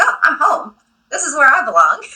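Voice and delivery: mock-relieved joy, pitched high; delighted realisation. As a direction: exclaim it like a happy homecoming. Acting out her delighted realisation.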